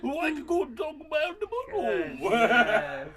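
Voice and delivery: high, loud, slow, through laughter; laughing protest. Real, not acted. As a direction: protest while cracking up. Cracking up at child's remark.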